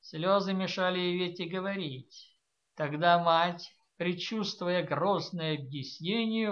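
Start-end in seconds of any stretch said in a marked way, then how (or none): none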